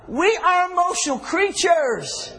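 high, slow preacher's cry; drawn-out pulpit shout; emphatic proclamation. Real, not acted. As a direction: proclaim it loudly to the congregation. Preacher proclaims his point forcefully.

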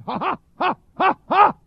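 imitated pulpit exclamations, rising in force; imitated preaching "ha". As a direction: mimic a preacher breaking into punched ha's. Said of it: "and he started going".

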